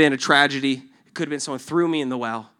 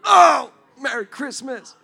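exclamation; 10 semitones higher, 9 dB louder, slower than usual. Acted. Acted exclamation, shouted.

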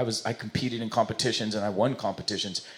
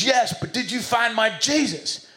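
high, insistent Southern preacher drawl; slow, pitched-up Southern accent; 10 semitones higher, slower than usual. Acted. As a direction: press the question slowly in a hammed-up Southern voice. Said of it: "he's kinda Southern".

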